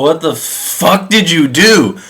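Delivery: slow, stunned disbelief; dazed outrage. Retold or imitated. Adam waking without a rib, slow stunned disbelief. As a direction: drag it out like he just woke up groggy.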